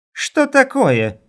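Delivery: high, loud, drawn-out outcry; indignant. A narrator's reading. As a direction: demand it in shrill-pitched outrage. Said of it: "возмутилась Ивонна".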